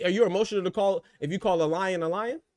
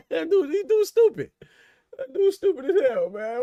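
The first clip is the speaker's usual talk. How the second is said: laughing hard; 13 semitones higher, 5 dB louder than usual. Real, not acted. Streamer cracking up at a viewer's comment, high laughing. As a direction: crack up mid-sentence.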